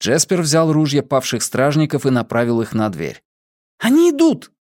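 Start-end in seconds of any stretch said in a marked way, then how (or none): 3.8-4.5 very high, urgent warning cry; alarmed cry. A narrator's reading. Warning as the door cracks open, alarmed cry. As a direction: cry out in alarm at top pitch. Repeated warning as guards break through.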